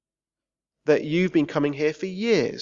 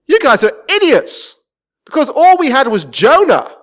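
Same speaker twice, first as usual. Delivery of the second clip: loud, high scolding outcry; shouted rebuke from the people of Nineveh; mock outraged Ninevites; 6 semitones higher, 10 dB louder than usual. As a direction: berate them like exasperated Ninevites. Ninevites scolding on judgment day.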